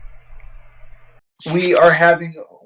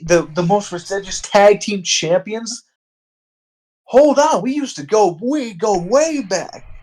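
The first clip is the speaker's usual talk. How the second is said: preachy Devon voice; 6 semitones higher than usual. Retold or imitated. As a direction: ham it up like a TV preacher calling out sacrilege. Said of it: "And Devon says".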